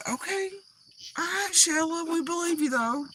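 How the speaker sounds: high, soft humouring voice; mock placating. Mock humouring of the woman discussed.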